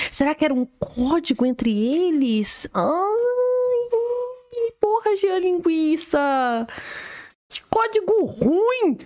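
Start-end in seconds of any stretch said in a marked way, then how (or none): 2.7-9.0 high, drawn-out groaning complaint; stretched high-pitched lament; mock exasperation. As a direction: wail in slow, hammed-up dismay. Mock exasperation at a bad code.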